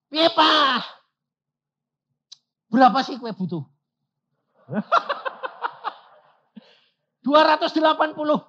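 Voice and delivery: put-on high, loud, excited young voice; eager child voice. Son asking his father about money, high and eager. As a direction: sound like an anxious kid asking dad.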